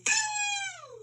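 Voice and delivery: high, long eerie boo; a ghostly boo that hangs in the air; spooky boo. A narrator's reading. Mysterious boo in story.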